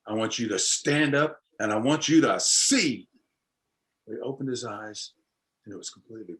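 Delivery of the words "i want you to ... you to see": emphatic, drawn-out faith healer's command; retold revival-style healing command. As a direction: build to a slow, loud final word. Healer commands blind boy in story.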